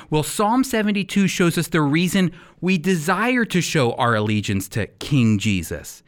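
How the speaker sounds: ordinary talk, nothing marked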